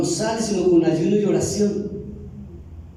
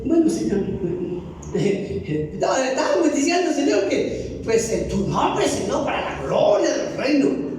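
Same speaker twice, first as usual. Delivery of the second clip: higher, slow, awkward self-justifying; sheepish excuse; 6 semitones higher than usual. Retold or imitated. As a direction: laugh it off and make excuses. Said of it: "el Señor cuando le dijo eso, dijo".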